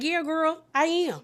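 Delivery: smirking reply. Retold or imitated. Jennifer smirking back at Danielle, smug. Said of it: "Jennifer's just smirking like".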